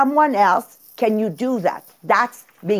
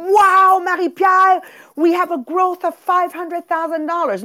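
exaggerated awe, pitched way up; mock excitement; 8 semitones higher, 3 dB louder than usual. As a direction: gush as if hugely impressed, hammed-up. Mimics a gushing reaction she rejects.

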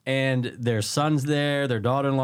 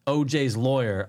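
ordinary talk

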